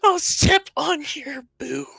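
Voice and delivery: high squeaky comic threat. Acted. Character threat, high put-on voice.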